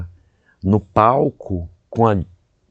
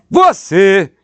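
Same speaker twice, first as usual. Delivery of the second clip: "Você" loud and high, both syllables hit hard; mock diva stressing "Você" evenly; stress on every syllable; 9 semitones higher, 6 dB louder than usual. Mimicking Fernanda, he says "Você" with every syllable stressed, loud and high. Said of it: "Eu boto tudo assim".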